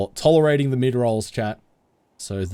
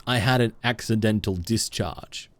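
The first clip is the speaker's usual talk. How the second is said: ordinary delivery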